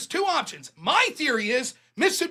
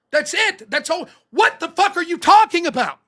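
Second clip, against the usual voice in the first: higher, louder, fed up; exasperated outburst; 8 semitones higher, 5 dB louder than usual. Real, not acted. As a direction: throw up your hands in disbelief. Exasperated rant after argument.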